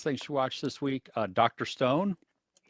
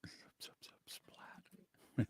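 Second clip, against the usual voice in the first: whispered bee noise; breathy whisper, no voice; 18 dB quieter, slower than usual. Pretend. Repeats the bee sound.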